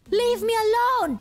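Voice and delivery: high, panicked mock yell; hammed-up scared yelp; retold yell. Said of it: "when I say I yelled, I just said, like".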